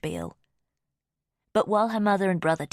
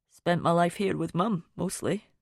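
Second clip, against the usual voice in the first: lightly voiced Scottish man. A narrator's reading. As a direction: humour him in a light Scottish accent. Finn's voice, about his life.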